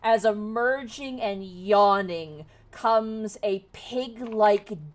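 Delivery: slow, loud game master narration; dramatic scene setting. Acted. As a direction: announce the monster's arrival with drawn-out menace.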